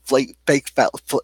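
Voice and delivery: stumbling and restarting over the words; tongue-tied. Real, not acted. Granddaughter venting about the cheap gift, tongue-tied. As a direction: trip over your words, too worked up to finish. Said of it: "I'm getting tongue-tied because I'm pissed".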